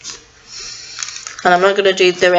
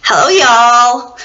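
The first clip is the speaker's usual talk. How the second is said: slow, loud y'all drawl in a put-on voice; slow hammed-up Southern American voice; put-on Southern American accent; 2 semitones higher, 5 dB louder, slower than usual. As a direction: ham up a Southern American twang. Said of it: "Do you like my voice".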